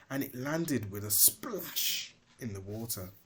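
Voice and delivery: usual speaking voice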